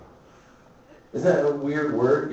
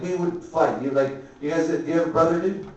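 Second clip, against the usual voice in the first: ordinary talk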